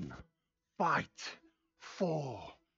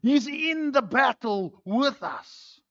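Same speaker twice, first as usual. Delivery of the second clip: ordinary talk, nothing marked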